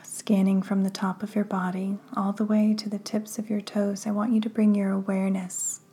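hushed, unhurried breathing cues; guided meditation. Acted. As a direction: ease the listener into calm, breath by breath.

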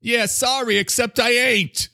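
very high put-on character voice with an accent; Mister Coffee. Coffee character chatting to the host, high accented voice. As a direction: squeal it as a cartoon coffee machine. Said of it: "you're doing my accent really good right now".

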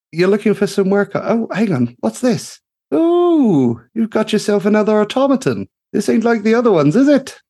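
bright-eyed gnome engineer calling out in delight; gushing gnome mechanic. Acted. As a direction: sing it out like a cheery little tinkerer. Greta spots the automaton.

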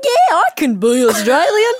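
put-on loud, high, stretched Aussie accent; exaggerated Australian accent. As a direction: overdo the Australian accent, slowly. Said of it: "It's, like, way more Australian".

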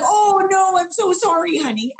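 a hint of the boyfriend apologising sweetly; apologetic boyfriend. Boyfriend apologising to her over the toilet seat, lightly voiced. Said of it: "And he's like".

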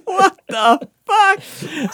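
high, slow, drawn-out mock shock; stunned disbelief. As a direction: stretch it out in comic disbelief. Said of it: "And I'm like".

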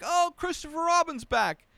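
high, sing-song imitation of him; lightly mock his naive cheer; mock cheerful character voice. Mocking Christopher's naive homecoming line, high sing-song. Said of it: "He's like".

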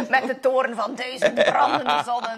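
hammed-up doom, laughing through it; laughing mock drama. Guest joking with her host about hating humanity, laughing mock drama. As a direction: play the grand curse for laughs.

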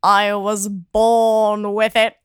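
low, slow Rickman-style line; slow and dry, like Snape; Alan Rickman voice. Retold or imitated. Imitating Alan Rickman's reply to a fan, low and slow. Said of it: "and he goes".

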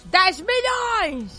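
drawn-out awed exclamation; slow stunned exclamation; excited. Real, not acted. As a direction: stretch it slowly in amazement. Hyped reveal of ten million.

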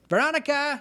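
calling out. Acted. Calling out for Veronica.

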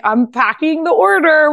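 high and slow mock-proud; imitating Kim. Kim telling friends she's packing it, high and slow.

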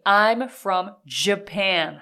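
I'm from Japan said slowly, each sound clear, Japan with a strong J; slow it down for the class to repeat; slow model phrase. Shown as a demonstration. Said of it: "repeat after me".

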